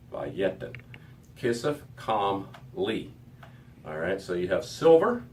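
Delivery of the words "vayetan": ordinary talk, nothing marked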